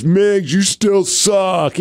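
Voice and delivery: slow, drawn-out mock heckle; drunk heckler. Drunk fan still taunting the wrestler, drawn-out mock heckle. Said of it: "There's a drunk guy".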